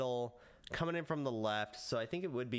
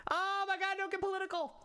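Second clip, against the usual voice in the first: much higher squeaky voice, hamming it up; mock falsetto; faster than usual. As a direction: squeal it in a high put-on voice. Friend voice reacting in falsetto.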